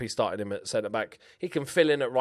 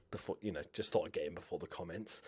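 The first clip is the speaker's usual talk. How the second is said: quiet aside; 6 semitones lower, 14 dB quieter, faster than usual. Real, not acted. Aside to pre-empt comments.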